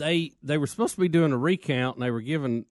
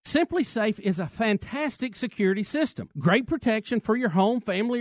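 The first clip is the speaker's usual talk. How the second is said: upbeat sponsor read; 4 semitones higher than usual. Acted. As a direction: pitch it like a radio ad. Reads sponsor ad.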